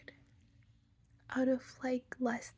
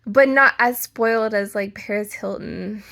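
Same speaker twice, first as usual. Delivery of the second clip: loud outburst; 13 dB louder than usual. Real, not acted.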